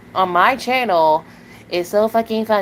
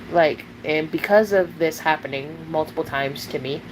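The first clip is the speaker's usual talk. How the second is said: normal talking voice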